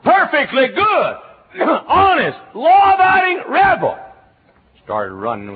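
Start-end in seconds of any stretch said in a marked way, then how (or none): 0.0-4.0 high, loud and drawn out; proud rebel shout. Acted. Preacher hamming up his rebel past, loud and proud.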